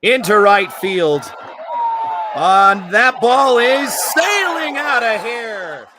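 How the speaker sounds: rising shout as the ball flies out; home run call. Real, not acted. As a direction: bellow the call, slow and soaring. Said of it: "I didn't expect to do the home run call".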